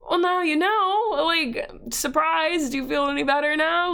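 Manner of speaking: high, sarcastic giving-in; exasperated surrender. Retold or imitated. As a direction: throw it out high-pitched and fed up. Retold caving in after spoiled surprise.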